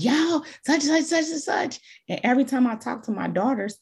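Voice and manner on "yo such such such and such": mock yelling. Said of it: "Imagine me talking to my girls".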